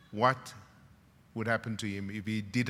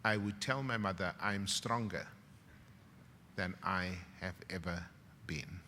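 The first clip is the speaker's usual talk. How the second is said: quiet quote; 3 semitones lower, 7 dB quieter than usual. Retold or imitated. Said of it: "this is what Nozi said".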